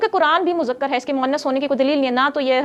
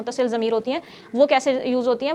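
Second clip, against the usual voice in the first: ordinary talk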